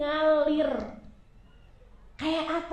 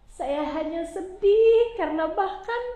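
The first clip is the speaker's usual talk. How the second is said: tearful lament; 7 semitones higher, 4 dB louder than usual. Acted. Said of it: "dalam isak tangisnya".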